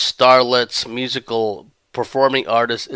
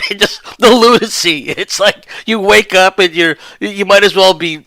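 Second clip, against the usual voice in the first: still laughing; 7 semitones higher, 5 dB louder than usual. Real, not acted.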